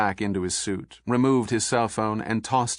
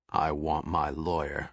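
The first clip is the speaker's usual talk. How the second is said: gruff suspect; 6 semitones lower, 5 dB quieter than usual. Acted.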